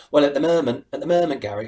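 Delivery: hint of a TV football pundit; lightly mimicked pundit catchphrase; Mark Lawrenson. Impressionist retelling another comic's catchphrase, lightly imitated pundit. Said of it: "do an impression of Mark Lawrenson".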